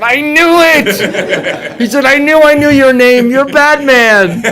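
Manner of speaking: high, loud thrilled exclamation; excited fan. Retold or imitated. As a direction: blurt it out in delighted recognition. Excited fan recognizing the actor.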